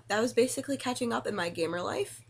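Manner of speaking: normal talking voice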